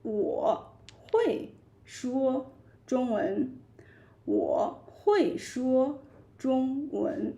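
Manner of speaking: "能说中文" spelled out very slowly; slow model sentence. Shown as a demonstration. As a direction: say it slowly for learners to copy. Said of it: "you can also say".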